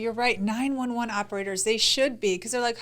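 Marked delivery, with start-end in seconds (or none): none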